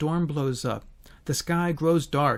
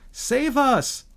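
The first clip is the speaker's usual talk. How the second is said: calling out; 8 semitones higher, 4 dB louder than usual. Acted. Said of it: "they call out to Jesus".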